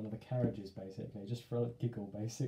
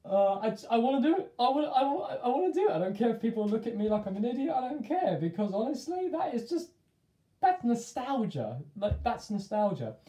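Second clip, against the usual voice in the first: much higher and louder, gushing; excited; 11 semitones higher, 6 dB louder than usual. Real, not acted. Gushing about running at wall.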